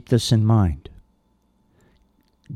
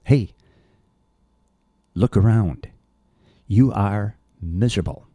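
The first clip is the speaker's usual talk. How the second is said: raised voice, as if calling someone out; mild mock shout; 2 semitones higher than usual. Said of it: "He's shouting".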